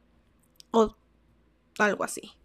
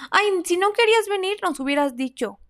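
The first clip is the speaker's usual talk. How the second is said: high, louder mocking whine from the friends; mock the friends' complaint, whiny and loud; whiny reproach; 8 semitones higher, 4 dB louder than usual. Friends complaining to her after the outing, a mock whine.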